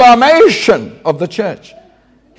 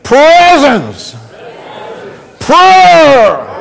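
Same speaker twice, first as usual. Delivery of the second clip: rousing mock Irish; 5 semitones higher, much slower than usual. Shown as a demonstration. Preacher getting the Irish to lose inhibitions, rousing mock accent. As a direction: lead the crowd, stretching each word in Irish accent. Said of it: "This is the Irish getting".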